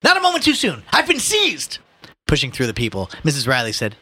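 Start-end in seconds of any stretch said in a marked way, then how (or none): 0.0-1.8 high, loud pompous alarm; calling out. Acted. Ignatius telling his mother he is seized, calling out. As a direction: cry out dramatically for rescue. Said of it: "he called".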